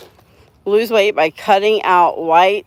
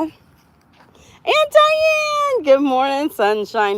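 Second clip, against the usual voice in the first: high, drawn-out squeal of the name; very high, delighted hello; excited greeting; 10 semitones higher, much slower than usual. Real, not acted. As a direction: squeal the name, then settle into cheery welcome. Greeting Aunt Diane as she arrives.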